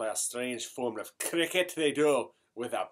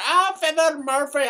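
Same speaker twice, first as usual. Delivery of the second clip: mock wail; 13 semitones higher, 7 dB louder than usual. Seamus wailing to priest.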